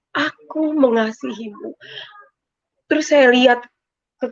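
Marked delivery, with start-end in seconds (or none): 0.1-1.7 slow, tender imitated voice; very gentle voice. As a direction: say it softly, lovingly drawn out. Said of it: "Itu lembut banget". (suara lembut, pelan dan penuh kasih)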